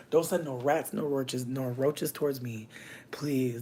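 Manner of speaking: ordinary delivery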